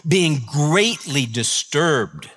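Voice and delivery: greatly drawn out very slowly; slow right down on greatly disturbed; reading the verse with weight; slow emphatic. Shown as a demonstration. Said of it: "They were greatly disturbed".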